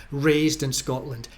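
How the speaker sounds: ordinary talk, nothing marked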